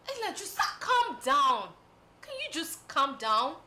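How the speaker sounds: high, loud demand for calm; exasperated shout. Acted. Friend to outraged Ella, exasperated shout. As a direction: plead loudly over her outburst.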